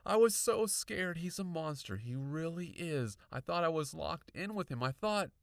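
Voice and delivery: high, agitated, starting loud; frightened insistence. A narrator's reading. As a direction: plead to be believed, frightened. Frightened girl insisting on her story.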